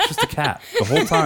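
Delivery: fast, high, laughing reveal. Real, not acted. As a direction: blurt it out while laughing. Joking about the twist.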